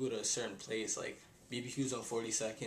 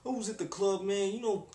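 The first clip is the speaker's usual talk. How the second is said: put-on Italian accent; 7 semitones higher than usual. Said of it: "I can't even do an Italian accent".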